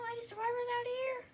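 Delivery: high, loud call outward; search party calling. Acted. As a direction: shout for anyone out there. Calling for survivors.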